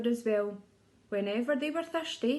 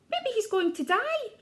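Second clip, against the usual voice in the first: high chirping little bird; lightly voiced bird, high; chirpy bird; 9 semitones higher than usual. A narrator's reading. Bird wondering about the crocodile, high and chirpy. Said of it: "chirped a bird".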